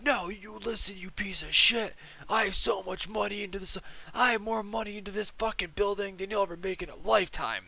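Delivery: high, ranting boss impersonation; mock freak-out. Boss berating delivery guy about money, mock furious rant. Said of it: "starts freaking out on the guy".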